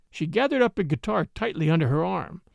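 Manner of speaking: ordinary delivery, nothing marked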